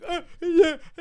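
high, cowering little laugh; lightly voiced timid thug; mock nervous stammer. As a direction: play the scared brother in the cab, tittering high. Scared brother in back of cab.

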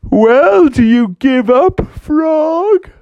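high, put-on elephant voice. Elephant offering a wrestle, high voice.

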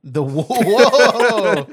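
cracking up mid-word; a burst of high laughter; laughing. Real, not acted. Laughing at the wood joke.

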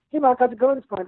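high, cheery nice guy; friendly greeting. Retold or imitated. Nice coworker greeting Mark, high and cheery. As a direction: greet him like a pal. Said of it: "he goes".

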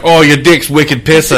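laughing mock Southie accent; Boston accent. Host mimics a Boston date he would reject, Boston accent. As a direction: put on a thick Boston townie accent. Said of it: "the way they talk".

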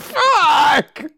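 sudden high yelled curse; mock outburst, high and loud; frustrated shout. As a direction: blurt it out like he's been robbed. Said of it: "He's like".

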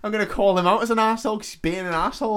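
high, loud mock protest; indignant waitress. Waitress defending herself to her boss, comically indignant. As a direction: argue back shrilly, verging on laughter.

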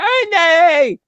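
loud, very high imitated shriek; Ernie's scream. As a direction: screech the name at top pitch. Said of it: "if I had video of Ernie screaming".

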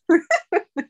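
very high giggle; incredulous high chuckle; real laugh. Real, not acted. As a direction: burst into short, high laughter. Laughs at everyone dying that year.

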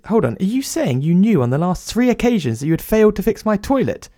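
high-pitched indignant questioning; indignant disbelief. Acted. Writer confronting plumber over failed repairs, indignant and high. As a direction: build in outraged pitch as the penny drops. Writer realises he was overcharged.

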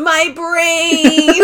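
high, drawn-out comic wail; mock agonized cry. Pee-wee pulled out by the plate in his head, mock pained yell. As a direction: wail it like a cartoon victim.

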